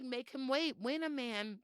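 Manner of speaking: usual speaking voice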